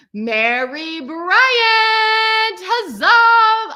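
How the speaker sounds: very slow stretched name then a high loud huzzah; excited cheer. Real, not acted. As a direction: trumpet the name like a herald. Announcing graduate name.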